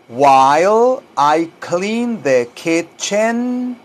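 very slow, stretched-out English; slow teaching model. Shown as a demonstration. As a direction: draw out each word for learners to repeat. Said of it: "tal como lo oye que yo lo digo". (modelo muy lento y alargado)

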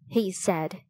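ordinary delivery, nothing marked

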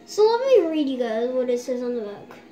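talking with mask on; through a sheet face mask. Real, not acted. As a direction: lips framed by wet mask. Seen 0: sheet mask covers face and mouth area throughout the labelled words.

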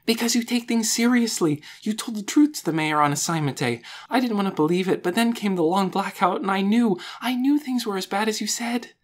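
high-pitched, excited outpouring; relieved rush. A narrator's reading. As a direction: let the words tumble out with relief. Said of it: "words poured out in a rush".

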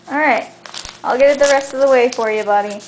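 usual speaking voice

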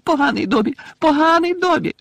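very high, loud self-scolding squeal; piercing elf squeak. A narrator's reading. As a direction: shriek it like a frantic little elf. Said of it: "пищав він".

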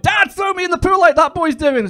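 put-on high kid's plea; whiny child. Pool kids begging their dads to copy the game. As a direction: kid pestering dad. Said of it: "going to their dads and like".

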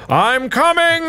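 high sing-out call. Pretend. Player calling to teammates he's coming, calling out. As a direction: call ahead as you approach.

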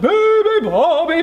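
loud, high bear bellow. A narrator's reading. Bears wake the sleeping bird, bellowing. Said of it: "bellowed the bears".

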